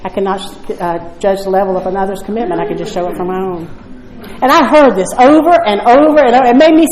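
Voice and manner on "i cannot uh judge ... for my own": soft, lowered, serene reply; imitating the sponsor's calm voice. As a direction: answer gently, unbothered. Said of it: "And she'd say".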